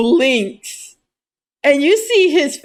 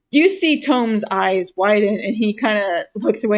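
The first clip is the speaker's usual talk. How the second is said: normal talking voice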